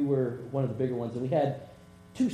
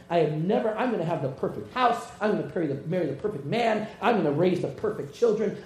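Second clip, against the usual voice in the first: defiant vow; 5 semitones higher, 6 dB louder than usual. Retold or imitated. Girl's defiant vow, imagined scolder.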